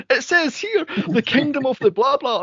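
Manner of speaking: high hammed-up scripture reading; mock her reading the verse with theatrical urgency; mock dramatic reader. Podcaster mimicking the wife reading out the Bible. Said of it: "and goes".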